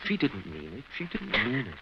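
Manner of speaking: low, soft and slow comfort; calm her down gently; soothing. Acted. Husband soothes upset wife.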